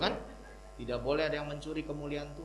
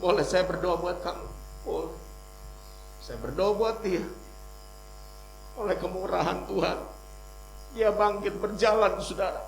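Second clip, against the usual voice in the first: raised, emphatic sermon voice; forceful preacher's delivery; preaching; 9 semitones higher, 6 dB louder than usual. Real, not acted. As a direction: proclaim it to the congregation, building in force.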